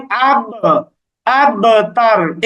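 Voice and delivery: "আবতার" slowly, with echoed b; bouncing qalqalah. Shown as a demonstration. Teacher gives a practice word, slow bouncing qalqalah. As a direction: bounce the b in "আবতার" before going on. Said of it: "এরকম উচ্চারণ হবে".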